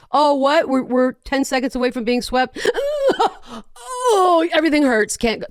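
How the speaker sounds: high, whimpering fake cry of a player ducking out; mock whining player. Host mocking Ben Simmons dodging the playoff game, high fake whimpering. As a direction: whine it like a fake injured crybaby.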